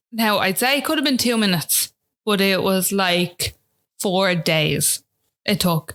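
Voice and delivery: normal talking voice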